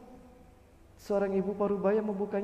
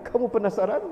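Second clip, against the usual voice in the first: ordinary delivery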